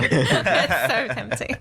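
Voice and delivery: put-on slow, low, laughing posh British accent. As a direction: drawl it like the Queen. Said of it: "do the Queen Elizabeth accent".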